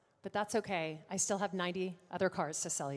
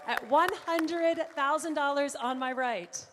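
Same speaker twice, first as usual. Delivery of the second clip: slow, much louder and higher announcement; 5 semitones higher, 11 dB louder than usual. Shown as a demonstration. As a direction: stretch the big number out so the room can cheer. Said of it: "I'm gonna say it slowly so you guys can applaud".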